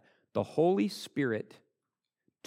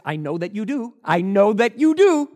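rising to a loud emphatic shout; Paul shouting the line over, higher and louder; mock Paul pressing; 9 semitones higher, 12 dB louder than usual. Preacher as Paul pressing King Agrippa, loud shout.

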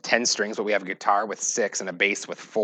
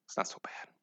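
whisper; 17 dB quieter than usual. Real, not acted. Whispered aside.

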